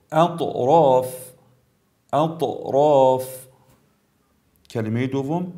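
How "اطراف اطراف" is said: slow اطراف with a bounced heavy ط; brief qalqala. Shown as a demonstration. Tajweed teacher shows letter ط, bouncing it in اطراف. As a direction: release the ط of اطراف briefly, then hold the long vowel. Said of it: "مثال حرف تا".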